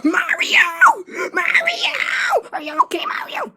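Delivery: high, crying calls of alarm; panicked cry. Acted. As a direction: scream his name in panic. Panicking over injured Mario.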